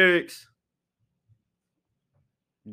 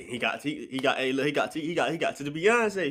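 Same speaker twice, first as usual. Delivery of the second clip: ordinary talk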